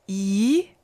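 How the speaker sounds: yi rising like a question; second tone. Shown as a demonstration. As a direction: let yi climb upward. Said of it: "Second tone".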